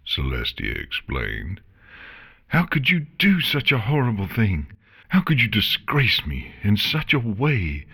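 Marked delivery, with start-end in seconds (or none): 2.5-7.9 high, indignant acted yell; mild yell of wounded pride; yelling back. A narrator's reading. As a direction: protest in outrage, pitched up. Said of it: "Chrysalis yelled back".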